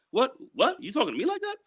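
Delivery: lightly acted offended officer; mock indignant cop. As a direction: play the cop bristling at being questioned. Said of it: "Kinda like".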